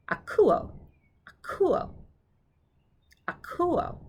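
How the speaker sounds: "akuo" said briskly, high on the middle syllable; kind of fast. Shown as a demonstration. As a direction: model "akuo" quickly. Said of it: "That was kind of fast".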